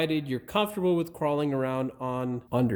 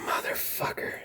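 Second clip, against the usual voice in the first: breathless hissed swear under the breath; whispered curse; 6 dB quieter than usual. Real, not acted. Mechanic cursing himself after fumbling, whispered swear. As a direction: curse it almost silently to yourself.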